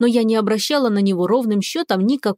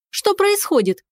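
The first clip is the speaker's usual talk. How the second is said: high, startled cry; shout; 9 semitones higher than usual. A narrator's reading. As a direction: call out over the yowling cat. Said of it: "крикнула я". (крик)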